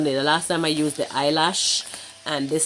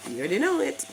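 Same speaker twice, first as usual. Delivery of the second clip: playful; 10 semitones higher, much faster than usual. Acted. Playful boast about competition.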